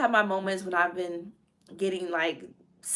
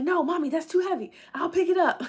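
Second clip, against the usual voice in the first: put-on high, protective boy voice; little man; 10 semitones higher than usual. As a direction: insist like a chivalrous little boy. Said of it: "He's like".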